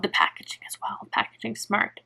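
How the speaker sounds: soft unvoiced whisper about the packaging. Real, not acted. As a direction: breathe the words, no voice at all.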